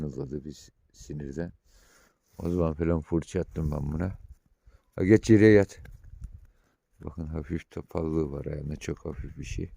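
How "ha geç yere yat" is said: louder, telling the dog to lie down; raised lie-down command. Real, not acted. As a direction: bark the order at the dog.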